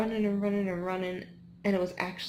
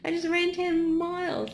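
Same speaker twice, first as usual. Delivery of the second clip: high, loud, strained thanks-but-go-away; grateful but fed up, pushed up high; exhausted runner snapping back at the crowd; exasperated runner; 10 semitones higher, 5 dB louder than usual. Retold or imitated. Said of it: "And you're like".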